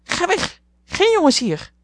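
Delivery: hoog, luid papegaaiengekrijs; krijsende papegaai. A narrator's reading. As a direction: krijs het uit als een papegaai. (krijsende papegaai, veel hoger)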